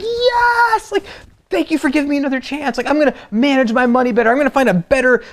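high, elated yell of thanks; overjoyed relief. Acted. Forgiven debtor thanking the master, overjoyed and high-pitched. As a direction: gush with giddy, rushing gratitude. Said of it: "how would you feel if that was you".

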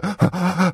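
sharp mock gasps for air; demonstrated gasp. Shown as a demonstration. Host showing a caller the cold shower effect, mock gasping. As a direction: gasp as if under cold water. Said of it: "it makes you go".

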